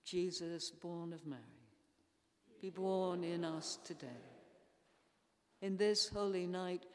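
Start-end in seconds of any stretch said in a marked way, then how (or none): none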